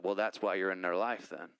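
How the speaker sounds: Louisiana voice. Retold or imitated. Mentor on the phone, lightly in his Louisiana voice. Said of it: "so that's why I use his voice".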